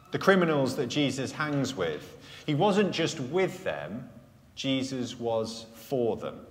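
ordinary talk

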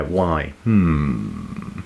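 ordinary talk

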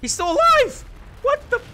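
high shocked yell; shocked shriek. Real, not acted. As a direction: shriek in disbelief. Enemy survived, shocked shriek.

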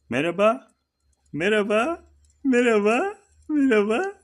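high, rising sing-song hellos to a turtle; pet greeting. Real, not acted.

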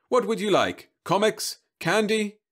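high, loud sugary pitch; robot salesman voice. Acted. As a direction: coax the child like a machine. Robot sells to child.

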